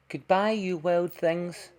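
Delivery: a hint of a girl waving goodbye; lightly voiced Red.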